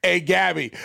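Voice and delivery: usual speaking voice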